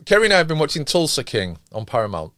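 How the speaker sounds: ordinary talk, nothing marked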